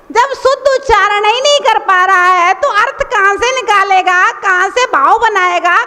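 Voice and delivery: ordinary delivery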